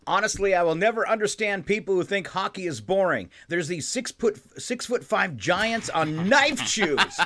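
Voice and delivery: high, lively reading out; excited tweet reading. Acted. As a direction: read it with rising excitement. Reads tweet with building excitement.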